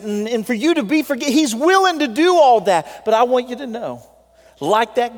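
swelling pulpit exhortation; full-force preaching. Acted. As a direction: plead and build with the congregation. Preaching builds again to the altar call.

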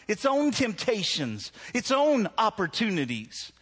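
ordinary delivery

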